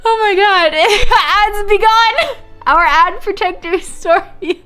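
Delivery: high, loud, drawn-out words through laughter; laughing exclamation. Real, not acted. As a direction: proclaim it while cracking up. Mock proclamation while laughing.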